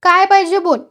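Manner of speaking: high, loud policeman's demand; impatient demand. A narrator's reading. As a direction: press him loudly for an answer. Irritated policeman questions complainant.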